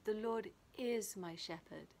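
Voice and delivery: "is" held and emphasized; stress on IS. Shown as a demonstration. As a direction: lean firmly on "is", affirming it. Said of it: "the emphasis on each word".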